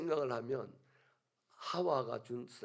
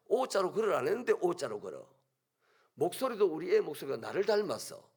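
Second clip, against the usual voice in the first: normal talking voice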